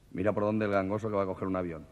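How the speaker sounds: usual speaking voice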